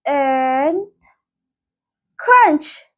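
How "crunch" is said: plain reading voice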